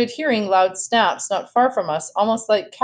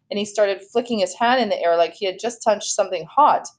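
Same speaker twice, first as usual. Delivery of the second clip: usual speaking voice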